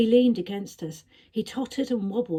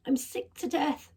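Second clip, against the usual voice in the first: weak sick voice; 7 semitones higher than usual. A narrator's reading. Ill creature speaks weakly.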